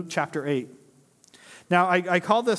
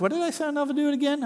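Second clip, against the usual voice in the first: mock forgetful churchgoer; 9 semitones higher, much faster than usual. Same churchgoer mocked at lunch.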